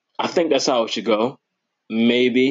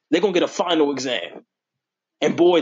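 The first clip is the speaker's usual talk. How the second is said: ordinary talk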